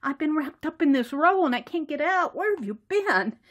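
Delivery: high-pitched fussy complaint; Macy, fussy. Acted. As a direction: whine at someone who kept you waiting. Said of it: "Macy's getting a little fussy".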